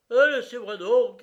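high, very loud protest; shouting. A narrator's reading. The brigadier, seized by the hand, protests in a loud shout. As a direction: yell to be let go. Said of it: "cria le brigadier".

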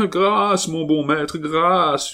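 high, tearful wail; wailing for mercy. A narrator's reading. Servant begging his master for mercy, wailing high. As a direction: cry out for mercy through tears. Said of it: "hurlait Longue Avoine en pleurant".

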